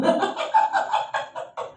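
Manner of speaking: airy high-pitched giggle; high breathless laugh. Real, not acted. Preacher cracking up at his own bakso story, breathless giggle.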